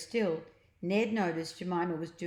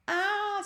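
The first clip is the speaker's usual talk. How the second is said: high falling scream; 4 dB louder than usual. A narrator's reading. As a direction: shriek as he flies up. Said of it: "screamed the boy".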